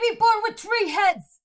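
high, loud newsboy shouting a headline; headline cry. Pretend. Quoting a newsboy's headline to co-host, shouted high. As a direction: call it out like a paperboy.

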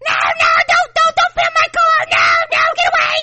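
high frantic shrieking; screaming Karen. Retold or imitated. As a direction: shriek at the camera. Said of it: "Karen who allegedly called someone".

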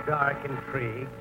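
normal talking voice

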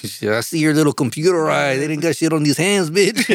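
hammed-up Chicano street voice.

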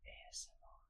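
breathy ASMR whisper. Pretend. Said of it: "whispering on a podcast".

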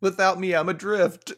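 hammed-up dramatic line. Finishing her friend's quote as a mock melodrama. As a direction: play it like a mournful poet.

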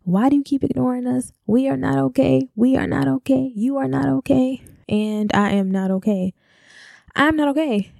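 put-on high, pleading cry of the inner child; inner voices yelling. Speaker's inner selves crying out to her, high and pleading. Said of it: "are all yelling".

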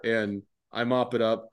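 ordinary delivery

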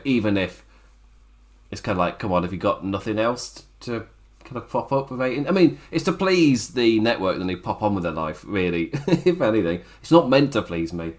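ordinary talk, nothing marked

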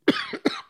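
sudden harsh cough; coughing fit. Real, not acted. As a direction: cough hard mid-sentence. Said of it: "I'm, uh, coughing here".

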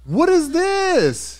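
slow stunned high question; shocked disbelief. Real, not acted. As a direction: drag it out in disbelief. Reacting to shocking fight video.

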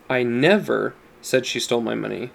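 "never" stands out from the sentence; stress on NEVER. Shown as a demonstration. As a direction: hit "never", then let the rest run plainly. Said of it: "depending on the emphasis you give".

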